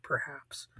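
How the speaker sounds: sly whispered "perhaps"; whispered aside. Real, not acted. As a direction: drop to a knowing whisper. Sly aside after debate advice.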